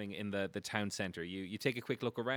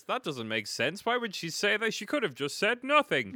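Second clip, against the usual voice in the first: high, loud, incredulous heckler; puzzled townsperson; 10 semitones higher, 5 dB louder than usual. Acted. Game master voicing someone in the crowd, incredulous. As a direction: question it loudly, pitch climbing.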